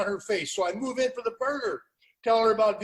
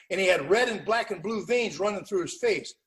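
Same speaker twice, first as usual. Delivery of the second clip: ordinary talk, nothing marked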